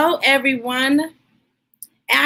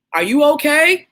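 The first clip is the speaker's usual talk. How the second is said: louder, higher call up the ladder; 4 semitones higher, 4 dB louder than usual. Acted. Dad calling up to Jabari on the high ladder, louder call. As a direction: call up to your son on the ladder. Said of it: "called his dad".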